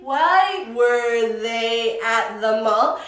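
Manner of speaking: very slow and separated, rising at the end; said slowly. Shown as a demonstration. Teacher shows students how it sounds said slowly, drawn out. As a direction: stretch each word apart like a drill. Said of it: "Cuando lo digo lento así".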